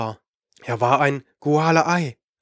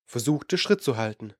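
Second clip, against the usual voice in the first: usual speaking voice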